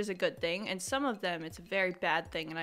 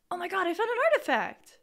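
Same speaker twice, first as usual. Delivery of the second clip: high, fast, louder delighted discovery; excited squeal; 11 semitones higher, 4 dB louder, faster than usual. Real, not acted. Gamer finding a rare item, squealing with excitement. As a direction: gasp it out, thrilled at the find. Finds a game artifact.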